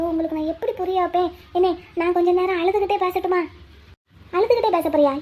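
ordinary talk, nothing marked

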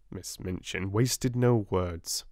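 ordinary delivery, nothing marked

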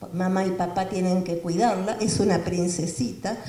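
ordinary talk, nothing marked

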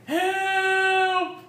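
high desperate yell; shouting for help. Acted. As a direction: cry out for help. Character yelling for help.

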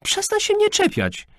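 very high-pitched yell; exasperated teenage shriek; exclaiming angrily. A narrator's reading. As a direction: scream at him to back off. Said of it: "wykrzyknęła Kamil".

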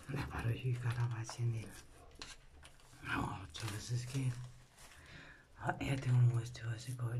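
low whispered cooing; tender whisper. Real, not acted. Mother whispering endearments to baby Chanel.

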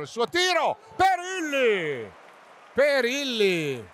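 high, stretched shout of the keeper's name; excited shout. Real, not acted. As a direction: call out the save. Another big save. (urlo per la parata)

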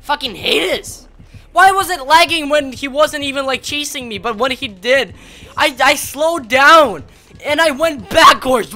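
loud and high, real frustration at the game; furious rant. Real, not acted. Gamer raging at lag in his game, loud furious rant.

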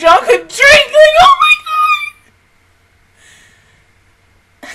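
high, loud, cracking with happy tears; real overjoyed yelling; tearful joyful shout. Real, not acted. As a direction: cry it out in disbelief and joy. Celebrating the characters' win.

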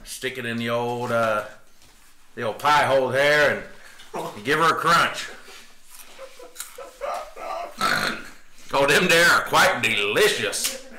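put-on playful old-West cowboy talk; hammed-up cowboy chatting over grub; cowboy accent. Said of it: "go straight with the cowboy".

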